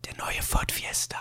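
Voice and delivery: hushed subliminal ad whisper. Pretend. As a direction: whisper it like a hidden ad slipped into your sleep. Mocking ad whispered into sleep.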